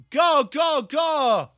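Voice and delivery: high, loud shouted cheer; excited kickoff shout. Real, not acted. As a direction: shout them off from the start line, high-pitched. Calling the kickoff.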